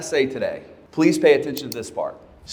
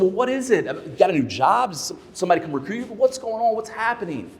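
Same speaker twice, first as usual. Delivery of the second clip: high-pitched, retold string of anxious questions; urgent questioning; 7 semitones higher than usual. Manager probing why his top producer may leave, urgent questioning. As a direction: pepper them with puzzled questions, pitched high.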